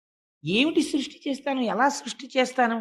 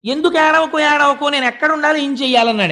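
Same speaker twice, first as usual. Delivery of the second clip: protesting question; 5 semitones higher, 5 dB louder than usual. A narrator's reading. Crying Rudra asks back.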